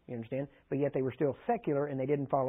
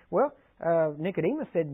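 usual speaking voice

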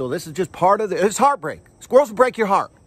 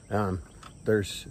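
ordinary talk, nothing marked

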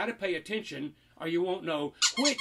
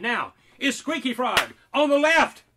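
louder, higher reveal of where the frog went; excited reveal; 4 semitones higher, 8 dB louder than usual. Acted. Showing the child the frog moved, excited reveal. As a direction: announce it like a magic trick.

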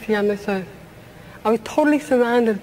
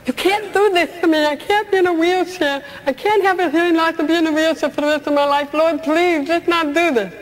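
high, raised plea to God, voice straining; pleading yell at God; 7 semitones higher than usual. Retold or imitated. From her wheelchair, begging God not to do this, raised plea. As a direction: cry out to God in desperation. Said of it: "I yelled at God".

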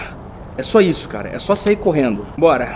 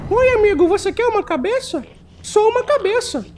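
high creepy voice. Acted. Creepy NPC offering a head.

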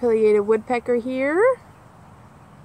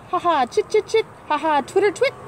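higher, twittering chirps; swallow twitter; 8 semitones higher than usual. Acted. Reading to kids, a high twittering swallow. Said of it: "Swallow slides from under a shingle".

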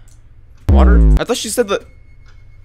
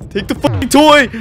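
high, loud frantic order; panicked shout; 13 semitones higher, 8 dB louder than usual. Real, not acted. Gamer handing the toy to the son, panicked shout. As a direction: shout it to get it over with. Frightened, shouting at game character.

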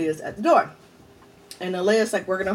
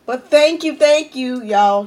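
louder, higher, emotional gratitude; tearful thanks; 4 semitones higher, 7 dB louder, slower than usual. Real, not acted. Thanking her friends for the gift, tearful. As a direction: push the thanks out through tears. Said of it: "Got your girl crying again".

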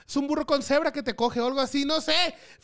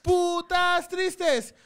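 loud, slow, drawn-out yell; stretched hype shout; shouted catchphrase; 6 semitones higher, 4 dB louder, much slower than usual. Real, not acted. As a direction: bellow it out to the crowd. Shouted catchphrase at show plug.

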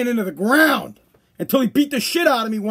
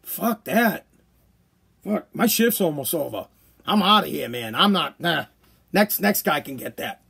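lazy cop; 6 semitones lower, 4 dB quieter than usual. Retold or imitated. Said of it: "Cop's gonna look at me and be like".

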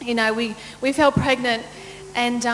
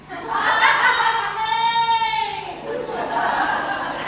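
high, sing-song delirious laugh; giggle-sing like you're high on drugs; 10 semitones higher than usual. Retold or imitated. On the delivery bed high on drugs, sing-song laughing. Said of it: "I'm just like".

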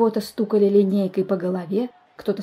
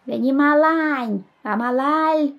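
put-on high, slow childish pipe; thin little voice; 6 semitones higher, 3 dB louder, much slower than usual. A narrator's reading. Small boy corrects the chairman, thin voice. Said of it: "поправил председателя тоненький голосок".

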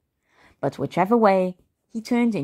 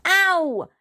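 very high yelp of pain; pained shriek; 13 semitones higher than usual. A narrator's reading. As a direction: shriek like a hurt child. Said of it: "shrieked Peter".

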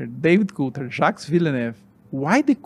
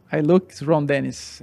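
ordinary talk

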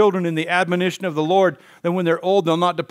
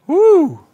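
whoop; 12 semitones higher, 9 dB louder than usual. Real, not acted. Exclamation about Five Points.

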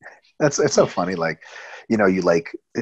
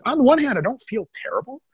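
lightly voiced Kai Green, pitched way up; high mock interview voice; 8 semitones higher than usual. Podcast host mimics bodybuilder answering interviewer, high mock voice. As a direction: play the big guy answering sheepishly, high.

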